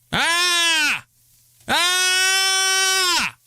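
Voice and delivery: high loud yell of Ah; test shout. Shown as a demonstration. Streamer checking mic levels with a loud high yell. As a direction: belt out a sharp high yell to peak the mic.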